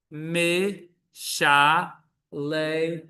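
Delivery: "me sha le" stretched out syllable by syllable; very slow syllables. Shown as a demonstration. Hebrew teacher reading word list, very slow syllables. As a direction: sound it out slowly.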